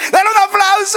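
high, loud call for applause; rally the crowd to clap; shouted call. Real, not acted. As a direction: shout it out to the whole church. Calling congregation to applaud.

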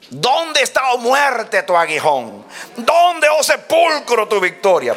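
high, declaimed challenge to death; soaring taunt that drops at each line's end; triumphant preaching. Acted. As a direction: cry out the scripture in triumph.

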